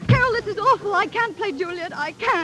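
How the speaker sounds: high, frantic protest; stage-fright panic. Acted. Pupil begs teacher before curtain, high and panicked. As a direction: panic and refuse in earnest.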